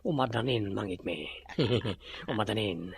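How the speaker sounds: low, soft sinister chuckle; gloating laugh. Acted. Villain gloats to his servant, chuckling low. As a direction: savour it like a schemer.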